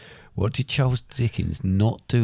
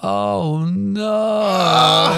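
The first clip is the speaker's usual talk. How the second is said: a high, loud wail of mock dismay; 10 semitones higher, 5 dB louder, much slower than usual. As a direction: ham up the disappointment. Quizmaster reacts to wrong answer.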